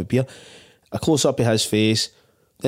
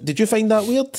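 incredulous question; 12 semitones higher than usual. Retold or imitated. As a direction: ask like it was the strangest thing ever. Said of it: "And I'm like".

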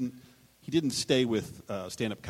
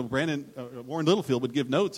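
usual speaking voice

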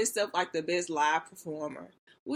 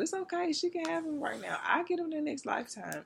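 mock gracious; 9 semitones higher, 4 dB quieter than usual. Real, not acted. Mock gracious about lost crush.

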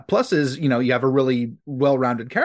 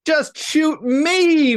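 jokey greeting; 11 semitones higher, 4 dB louder, much slower than usual. Acted. Greeting with sitcom title.

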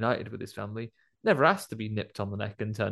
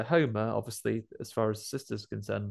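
ordinary talk

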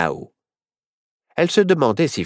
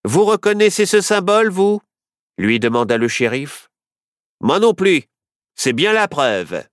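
sheriff voice; 7 semitones higher than usual. A narrator's reading. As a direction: play the sheriff, pitched up and brash. Sheriff character voice.

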